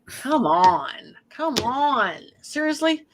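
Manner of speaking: ordinary talk, nothing marked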